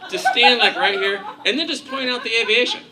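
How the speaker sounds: normal talking voice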